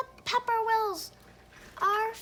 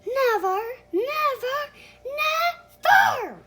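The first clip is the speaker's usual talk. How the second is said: louder and higher on every never, rising to higher pitch; crying out; 5 semitones higher, 9 dB louder, slower than usual. Acted. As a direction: build the refusal, each never bigger. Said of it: "they cried".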